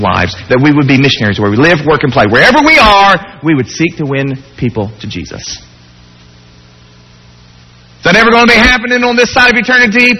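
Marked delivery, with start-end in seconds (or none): 2.3-10.2 preacher rising to stir his church; preaching peak. Real, not acted. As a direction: lift the crowd with your dream.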